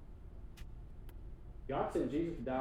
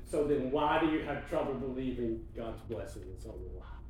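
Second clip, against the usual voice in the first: normal talking voice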